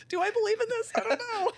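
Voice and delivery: high, laughing mock self-doubt; unsure, laughing. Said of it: "'Cause you're like".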